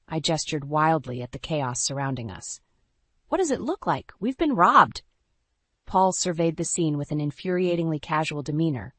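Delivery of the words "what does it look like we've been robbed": high, frantic, loud at the end; incredulous panic, rising to a shout; wife shouting at her calm husband, frantic; exasperated. A narrator's reading.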